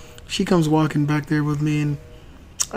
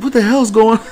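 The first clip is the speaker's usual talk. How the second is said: exasperated; 5 semitones higher, 4 dB louder than usual. Retold or imitated. Dad baffled, turning to wife.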